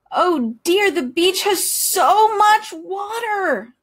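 high slow overwhelmed duck; awed worry. A narrator's reading. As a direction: gasp at all that water. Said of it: "quacked Duck".

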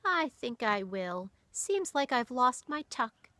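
put-on slow, tired old woman; sighing. A narrator's reading. Granny going to bed, tired and sighing. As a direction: sigh it out wearily. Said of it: "Granny sighed".

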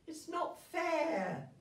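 put-on high, slow childish whine; jealous brother whine. Jealous brother complaining about Marie's nutcracker, whiny. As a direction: whine like a sulking little boy. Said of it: "he cried".

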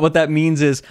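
ordinary talk, nothing marked

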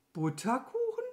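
high, puzzled question; surprised rise in pitch; astonished. A narrator's reading. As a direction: ask in disbelief. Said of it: "fragte Mama Mu erstaunt".

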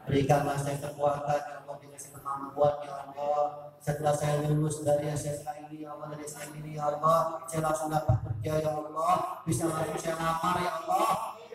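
a student's humble, hushed prayer; earnest prayer. Pretend. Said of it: "kalau kalian doa".

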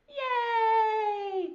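high, loud bird cheer; puppet cheer. Acted. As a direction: squeal with joy. Puppet cheers after the song.

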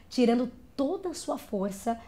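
ordinary talk

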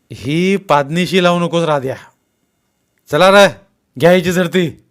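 loud, high officer's orders; angry command. A narrator's reading. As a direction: order your men to search. Policeman orders a search.

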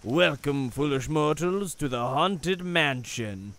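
slow, ghostly ride narrator voice; Haunted Mansion host. Pretend. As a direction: intone it like a spooky host. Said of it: "It does look a lot like the Haunted Mansion".

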